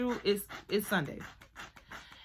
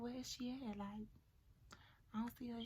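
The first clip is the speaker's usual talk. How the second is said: much softer, hushed question; hushed whisper attempt; 3 semitones higher, 16 dB quieter than usual. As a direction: keep it down so nobody hears. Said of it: "I'm trying to whisper".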